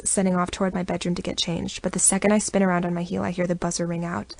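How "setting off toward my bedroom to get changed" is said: ordinary delivery, nothing marked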